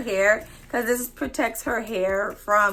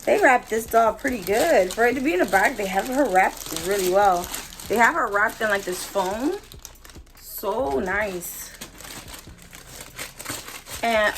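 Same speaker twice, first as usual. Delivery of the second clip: ordinary talk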